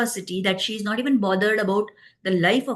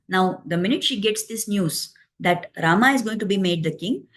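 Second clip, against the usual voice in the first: ordinary delivery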